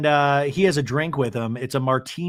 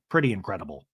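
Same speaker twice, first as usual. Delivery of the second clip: low, soft mock admiration; Todd, deadpan; 7 semitones lower, 6 dB quieter, faster than usual. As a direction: imitate him murmuring low and deadpan. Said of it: "And Todd's like".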